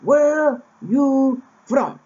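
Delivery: put-on very slow, high, loud old man repeating the question; insistent old man. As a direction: drag out Where you from? slowly and loudly. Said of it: "and he says".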